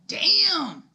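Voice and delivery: loud, high exclamation of shock; re-enacted shocked holler; stunned outburst. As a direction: blurt it out high and loud, acting floored. Her stunned reaction to the insults.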